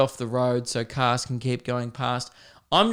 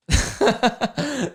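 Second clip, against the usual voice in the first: high, helpless laugh; burst of laughter; 11 semitones higher than usual. Real, not acted. Storyteller cracking up at the crashed car, high laugh.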